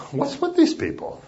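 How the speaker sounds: high, baffled outsider's question; mock-bewildered, voice jumping up high. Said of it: "they probably sit and go".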